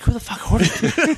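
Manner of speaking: high-pitched mock bafflement with a laugh; baffled outburst. Store clerk recalling mystery Spider-Man trades, laughingly baffled. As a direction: laugh through a retold, puzzled outburst. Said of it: "I was like".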